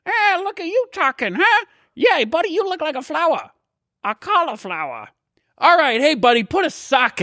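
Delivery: put-on squeaky sock puppet teasing the grieving kids; a goofy hand-puppet sidekick cracking jokes; Otto the sock puppet. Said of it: "my buddy Otto".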